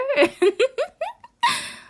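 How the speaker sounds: very high, loud unhinged laughter; shrieking giggle; manic laugh. Acted. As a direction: keep laughing at the idea. Laughing again after the joke remark.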